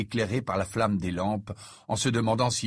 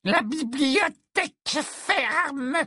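very high, slow, drawn-out screech; piercing put-on old harpy voice; shrill stern librarian; much slower than usual. A narrator's reading. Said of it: "dit-elle d'une voix sifflante".

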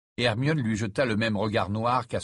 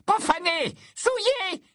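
very high, screechy condemnation; piercing outraged harpy voice; hissing librarian; slower than usual. A narrator's reading. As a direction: shriek each word in horror. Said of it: "dit-elle d'une voix sifflante".

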